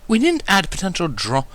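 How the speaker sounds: high, refined lady chiding lightly; gentle reminder. A narrator's reading. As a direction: remind her friend, elegantly. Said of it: "Rarity reminded gently".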